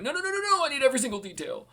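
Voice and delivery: very high, hurried mock plea; eager listener's protest. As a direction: blurt it high and loud, imitating an impatient listener. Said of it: "and he's like".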